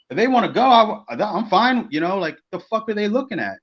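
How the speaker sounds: high, indignant bravado; retold defiance. Retold readiness to fight after staredown.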